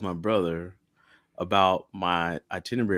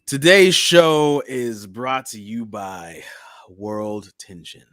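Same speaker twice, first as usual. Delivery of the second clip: mock sponsor read; 6 dB louder, slower than usual. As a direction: drag out a grand sponsor announcement, then let it fall. Mock sponsor announcement opening the show.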